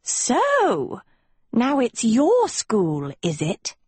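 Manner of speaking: drawn-out scornful "your school"; slow mocking sneer. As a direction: sneer every word slowly.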